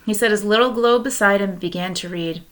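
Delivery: ordinary delivery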